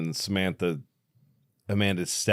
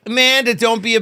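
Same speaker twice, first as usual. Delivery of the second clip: higher, louder taunting call; mock yell; 7 dB louder, faster than usual. Kids in the crowd yelling at Amanda, high and loud. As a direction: egg the girl on with a yell. Crowd urging the girl to kiss.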